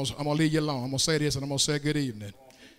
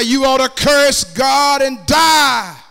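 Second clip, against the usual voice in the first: loud, raised, slow preacher's take on a frustrated wife; 7 semitones higher, 10 dB louder, slower than usual. Acted. Job's wife counseling her husband, frustrated shout. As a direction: hurl it out like a wife at the end of her rope. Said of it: "Job's wife got so frustrated".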